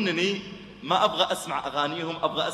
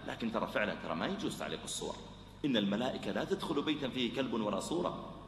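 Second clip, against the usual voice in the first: soft, much lower gentle counsel; gentle advice; 6 semitones lower, 8 dB quieter than usual. Acted. Preacher gently advising the shopkeeper about pictures, soft and low. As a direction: advise him quietly. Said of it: "قل له بعد ذلك".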